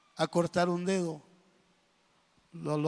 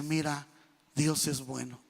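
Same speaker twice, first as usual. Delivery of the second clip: humbled king; 5 semitones lower, 4 dB quieter than usual. Acted. Humbled king admits to servant.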